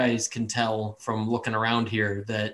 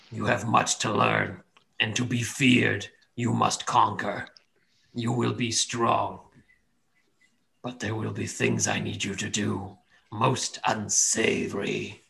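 cold rasping evil emperor; slower than usual. Acted. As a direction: menace him slowly like a dark deity. Deity voice commands.

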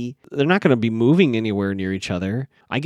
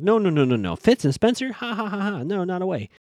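high, rattled mock laughter; pitched-up denial with acted ha-ha laughs; freaked-out laughing denial; 7 semitones higher than usual. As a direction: imitate a panicked laugh-it-off denial. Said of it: "freaks out and then starts laughing".

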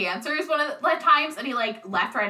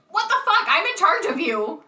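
exasperated aunt; 7 semitones higher, 3 dB louder than usual. Retold or imitated. Aunt voiced as angry.

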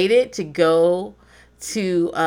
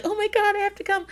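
much higher, whimpering mock despair; high whine; 13 semitones higher than usual. Giving in to friends, high mock whine. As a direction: squeak it out in defeat. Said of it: "I'll be like".

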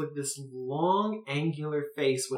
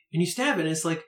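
usual speaking voice

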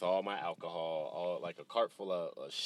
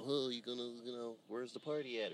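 higher, mocking party-guy voice; a customer's cheesy party quip, higher and mocking; mock partygoer; 6 semitones higher, 7 dB quieter than usual. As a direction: toss off a lame party joke in a higher voice. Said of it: "they're like".

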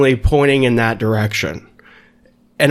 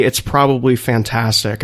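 usual speaking voice

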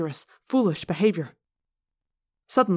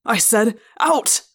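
loud command, exploding on the last word; roared order; 3 semitones higher, 6 dB louder, slower than usual. A narrator's reading. Assistant principal silencing teacher, roaring. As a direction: roar it to cut him off. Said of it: "Chapman roared".

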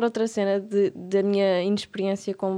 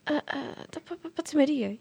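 embarrassed stammer; 6 semitones higher, 7 dB quieter, slower than usual. Retold or imitated. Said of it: "meio encavacado".

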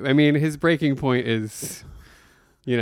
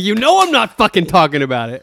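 loud, higher resentful snap; mock angry; 5 semitones higher, 5 dB louder than usual. Host voicing the character snapping at George, louder and higher. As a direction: throw it at him in irritation.

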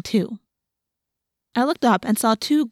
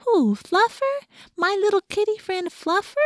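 put-on baby talk to a pet; 11 semitones higher than usual. A narrator's reading. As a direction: play dumb in a high sweet pet voice. Defending the cat in baby talk.

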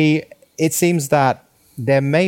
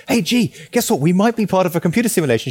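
pitched up, eager announcement; quick, high-pitched mock excitement; excited retelling; 6 semitones higher than usual. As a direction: imitate yourself breaking big news excitedly. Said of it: "talk to Georgie about this excitedly".

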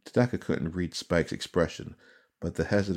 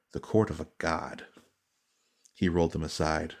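ordinary delivery, nothing marked

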